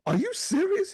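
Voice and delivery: high, squealing disbelief; wife excited about the AirPods, high; mock disbelief. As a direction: shriek it, thrilled and amazed. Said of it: "She goes".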